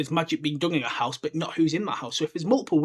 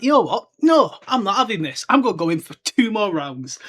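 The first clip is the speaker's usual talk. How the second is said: mock defiant Vernon; 4 semitones higher, 5 dB louder than usual. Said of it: "But Vernon's like".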